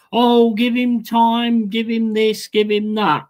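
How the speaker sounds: mocking quote. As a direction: parody the patient fans with a sneer. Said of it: "you can keep saying".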